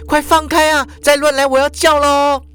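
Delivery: very high-pitched cry; shouted warning. A narrator's reading. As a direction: warn off a groper in alarm. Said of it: "大叫说". (惊慌尖声大叫)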